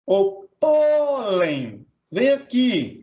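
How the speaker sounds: "pólen" called out with a long-held PÓ; "pólen" drawn out on its first syllable, then falling; calling the word. Shown as a demonstration. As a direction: stretch the PÓ of "pólen" as if summoning someone. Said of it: "mais o pó".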